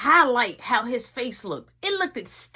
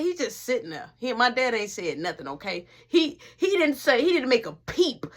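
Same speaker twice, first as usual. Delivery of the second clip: usual speaking voice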